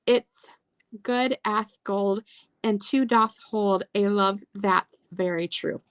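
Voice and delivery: put-on "Juth" and "Thith" with a slow, childlike lisp, s as th. Shown as a demonstration. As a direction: read it slowly, lisping every s like a lost tooth. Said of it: "I said it just like it puts it, with that little, little, like, lisp".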